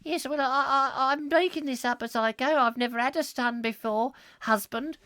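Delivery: high prim wife voice. Acted. Wife answering husband about baby, high prim voice. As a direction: pitch it up as the fussing wife.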